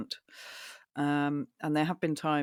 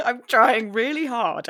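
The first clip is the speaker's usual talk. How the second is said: higher, slow mock plea; playful protest, pitched high and slow; mock earnest; 8 semitones higher, 8 dB louder than usual. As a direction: protest in a pretend wounded voice. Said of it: "it sounds like I'm not even trying".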